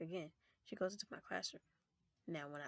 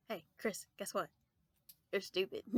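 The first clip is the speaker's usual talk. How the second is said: taunting; 4 dB louder than usual. Retold or imitated. Taunting friend again.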